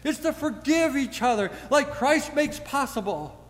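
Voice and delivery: preacher crying out the answer, high and loud; rhetorical answer. Acted. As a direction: answer your own question with a joyful shout. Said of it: "the rhetorical response is".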